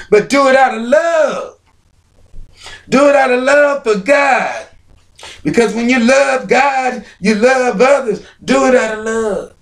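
high, loud climactic preaching cry; full-force preaching. Acted. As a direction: build up and shout the call to love God.

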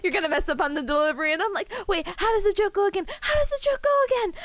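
high, loud panicked self-mimicry; mock flustered. Streamer mimics herself botching a joke delivery, mock flustered.

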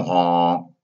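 a honk slipping out like a donkey; a puppet boy turning into a donkey, honking by accident. Acted. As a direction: honk like a donkey mid-sentence. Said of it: "said Pinocchio".

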